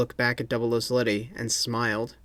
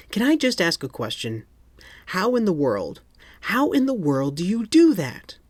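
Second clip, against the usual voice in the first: exasperated spy; 7 semitones higher than usual. A narrator's reading. Sletty exasperated question.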